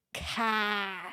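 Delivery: "Ka!" tossed out in a Boston accent, R dropped. Shown as a demonstration. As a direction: bark out "Ka!" like a Bostonian. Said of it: "they say ka".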